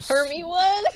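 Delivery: high squeaky echo of the question; mock squeaky echo. Teasing a friend by echoing the question back, squeaky. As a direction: parrot the question back in a silly voice.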